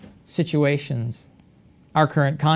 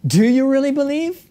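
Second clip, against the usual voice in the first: questioning, high-pitched doubt; doubtful question; 7 semitones higher than usual. Retold or imitated. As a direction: ask it as if he can hardly believe it. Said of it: "translated as a question".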